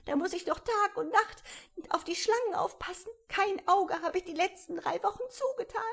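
high, put-upon bird voice, not listening to anyone; fussy pigeon. A narrator's reading. The pigeon complaining.

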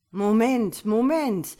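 loud, high opening calls, then settling to an offer; urgent stalling, easing off; placating interjection. A narrator's reading. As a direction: call out to halt him, then reason. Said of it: "begann er und hob beschwichtigend".

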